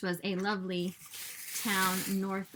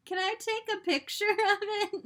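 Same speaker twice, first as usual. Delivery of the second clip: excited; 13 semitones higher, 5 dB louder than usual. Retold or imitated. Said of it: "I'm like".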